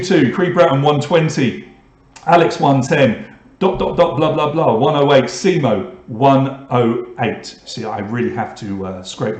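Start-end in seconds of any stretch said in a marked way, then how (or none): none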